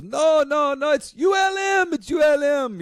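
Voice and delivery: squeaky yelled impression of the producer insisting; hammed-up high-pitched yell, no, no, it's U L M; imitating Isaac. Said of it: "he finally goes".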